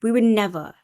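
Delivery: huffy, offended denial; mock indignant. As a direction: play it haughty and scandalized. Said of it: "he gets really mad".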